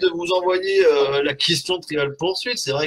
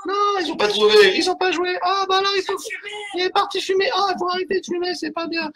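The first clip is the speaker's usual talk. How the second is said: much higher put-on squeaky voice; squeaky audience voice. Host plays the absent audience in a much higher squeaky voice. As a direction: answer for the crowd in a tiny high voice.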